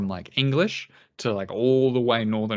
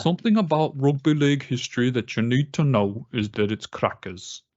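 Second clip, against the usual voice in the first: put-on English accent. Co-host asked for an English accent, he tries northern English. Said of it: "give me your best English accent saying it's crackers".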